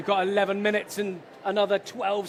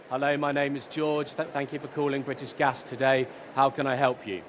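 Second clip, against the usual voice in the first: lower, uninterested phone greeting; bored call-centre voice; 7 semitones lower than usual. Retold or imitated. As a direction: sound like you don't want to help at all. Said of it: "You can hear it in their tone of voice".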